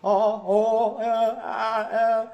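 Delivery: imitating classical male singers. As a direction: parody a singer fumbling his vowel. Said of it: "and they're like".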